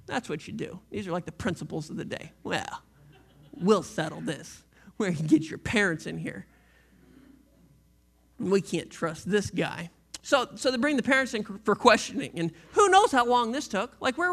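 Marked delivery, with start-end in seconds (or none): 2.4-9.9 pompous principal. Retold or imitated. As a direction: play a stuffy principal laying down the law. Principals of the day voiced.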